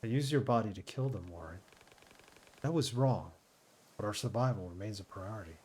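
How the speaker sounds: lightly voiced calm AI; mild robotic ship computer. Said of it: "Trying to do, like, a computer voice here".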